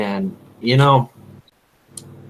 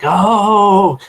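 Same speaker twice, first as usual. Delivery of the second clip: sudden, much higher, loud "Oh"; startled oh; 9 semitones higher, 7 dB louder than usual. Retold or imitated. Hunter hit by bird droppings, startled yelp. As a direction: yelp in shock. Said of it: "he's like".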